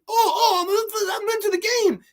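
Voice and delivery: very high, whining mock excitement; mocked coach. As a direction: squeal it to ridicule an overexcited sports parent. Mocking overinvolved coaches living through sons.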